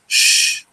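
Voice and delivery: "sh" as a long voiceless hush; isolated consonant. Shown as a demonstration. Teacher sounding out a consonant for students, isolated sound. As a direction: sound out "sh" alone, no vowel. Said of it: "then a consonant".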